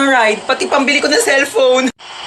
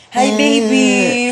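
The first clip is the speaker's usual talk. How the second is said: put-on tender to baby; 3 dB louder, much slower than usual. Mother greets newborn.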